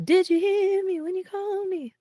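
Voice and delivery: put-on high, slurred drunk father voice; drunk dad voice. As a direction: imitate a drunk dad calling. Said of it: "he'd call me when he'd get really drunk".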